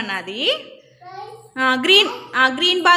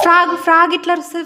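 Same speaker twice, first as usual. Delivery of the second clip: quick bright question, higher; fast sing-song quiz; 4 semitones higher, faster than usual. Real, not acted. Mother quickly quizzing her baby on the frog's call. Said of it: "quizzing baby on animals".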